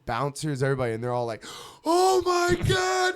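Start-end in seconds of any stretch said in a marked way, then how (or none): 1.8-3.1 very high, stretched-out shriek; drawn-out mock scream, way up high; mock crowd scream. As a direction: imitate horrified onlookers screaming. Crowd screams at near miss.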